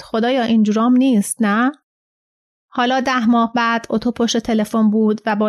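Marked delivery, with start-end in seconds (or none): none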